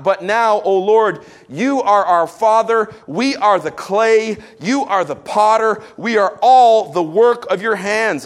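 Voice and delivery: loud, slow scripture proclamation; solemn proclamation. Acted. Preacher proclaiming the potter verse, slow and loud. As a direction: proclaim each clause to the room.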